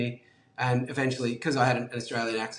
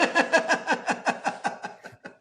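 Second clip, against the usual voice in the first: laugh. Real, not acted. Laughing at his own joke.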